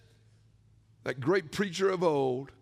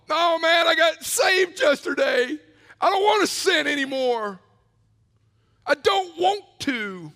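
louder, higher refusal of the bar invite; firm refusal; 8 semitones higher, 6 dB louder than usual. Retold or imitated. New convert refusing his drinking buddy, louder and firmer. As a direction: refuse it with your whole chest. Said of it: "Dude, come on".

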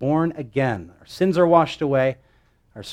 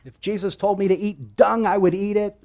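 much higher, indignant mock outburst; upset Luther; 8 semitones higher than usual. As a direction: channel an upset Luther insisting. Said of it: "Martin Luther was very upset".

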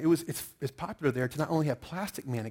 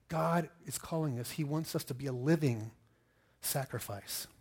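usual speaking voice

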